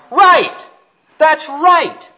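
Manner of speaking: sudden high, full-voiced holler. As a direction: holler it out like a startling discovery. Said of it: "I leaped up and I hollered".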